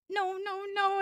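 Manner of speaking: high, softened string of mock noes; dismayed protest. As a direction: imitate someone protesting in dismay, pitched well up. Acts out Emma's dismayed refusal.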